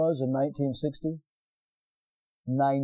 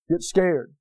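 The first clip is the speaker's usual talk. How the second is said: ordinary delivery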